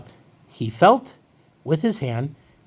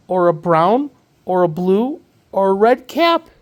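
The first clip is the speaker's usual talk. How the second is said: louder, stretched list, each colour lifting; building list; 2 semitones higher, 4 dB louder than usual. A narrator's reading.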